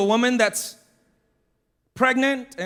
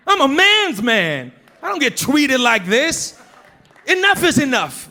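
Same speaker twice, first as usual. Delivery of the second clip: mock indignant; 4 semitones higher, 4 dB louder than usual. Mock outrage, fed up.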